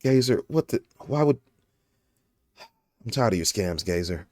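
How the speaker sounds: exasperated, with long pauses and a falling voice; Steuben's weary monotone. Acted. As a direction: sigh it out, fed up and monotone. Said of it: "I would talk real monotone like this".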